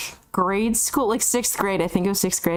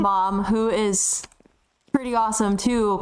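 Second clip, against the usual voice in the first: normal talking voice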